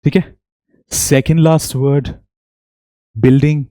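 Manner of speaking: usual speaking voice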